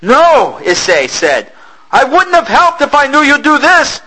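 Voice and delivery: high, loud anguished cry; horrified outburst. Acted. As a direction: cry out in horror.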